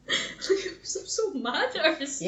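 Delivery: giggling fake fury; laughing mock anger. Real, not acted. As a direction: laugh through a pretend grudge.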